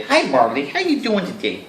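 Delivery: high cooing pet voice. Real, not acted. Greeting the brother's dog in a high cooing voice. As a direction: coo at the dog sweetly.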